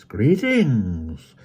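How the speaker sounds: villain voice, harsh and grating; menacing, grating villain greeting. A narrator's reading. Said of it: "harsh, grating voice".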